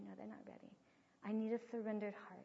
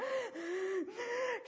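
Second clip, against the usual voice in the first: put-on sharp gasp of a child holding back tears; mock held-back sob gasp; imitated gasp; 12 semitones higher, 4 dB louder than usual. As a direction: gasp in like a little boy fighting tears. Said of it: "and he was going".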